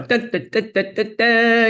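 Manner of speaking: high-pitched mock razzle-dazzle fanfare; upbeat showbiz "da-da-da". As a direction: send up a peppy stage entrance. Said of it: "rather than being e-, engaging, but not slapstick".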